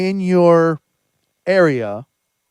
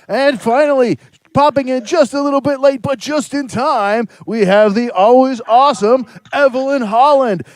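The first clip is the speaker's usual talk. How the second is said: upbeat host intro; 7 semitones higher, 4 dB louder, faster than usual. Acted. Host introduces next panelist.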